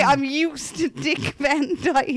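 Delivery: slow, drawn-out mimicry; imitating a silly remark; mocking quote. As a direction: parrot it back slowly in disbelief. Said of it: "Did you just say".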